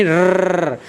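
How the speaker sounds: put-on long rolling R, like a child's engine; trilled car noise. Shown as a demonstration. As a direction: demonstrate the tongue's vibration in a sustained "रर्रर्र". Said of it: "किससे किया हमने?". (जीभ कंपाकर लंबी रर्रर्र)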